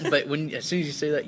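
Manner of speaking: ordinary talk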